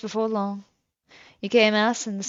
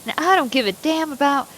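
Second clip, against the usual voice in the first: usual speaking voice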